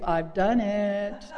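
slow, stretched-out boast; drawn-out claim. Retold or imitated. Woman claiming she finished her inventory, slow and drawn out. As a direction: stretch the words out, lightly.

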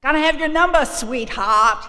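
loud, high Boston tough-guy voice, repeated; Boston accent. Retold or imitated. AA volunteer insisting on her number, loud Boston accent. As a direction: punch out the brash Boston demand. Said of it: "That's how they talk up there".

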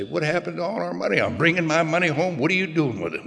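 imitating his old self's indignant whine; mock indignation. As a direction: play the wronged husband demanding the money. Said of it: "I would say".

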